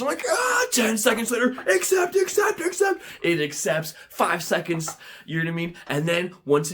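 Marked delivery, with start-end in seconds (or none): 0.3-0.7 high mock scream; acted high shriek of alarm; panicked scream. Bidder interrupted by a warning, mock panicked scream. 1.7-3.0 high, urgent repeated shouts; frantic shout. Acted. Bidder clicking with seconds left, frantic shout.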